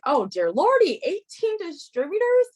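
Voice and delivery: high-pitched gasp of amazement; shocked disbelief. Retold or imitated. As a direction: squeal it in disbelief. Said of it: "You're like".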